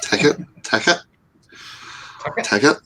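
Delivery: "ticket" in a New Zealand accent; New Zealand vowels on "ticket". Shown as a demonstration. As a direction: try out "ticket" as a New Zealander says it, repeatedly. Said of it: "I'm gonna try and say".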